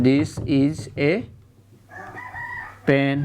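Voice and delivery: very slow, with a long pause before the last word. Shown as a demonstration. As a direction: dictate it slowly, each word apart. Teacher repeats example word by word.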